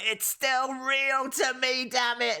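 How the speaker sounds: mock-crying fan, high and stretched out; upset, tearful outburst. Retelling what the fan said at the Q and A, mock-upset cry. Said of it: "he was getting all upset".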